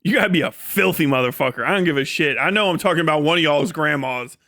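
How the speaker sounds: usual speaking voice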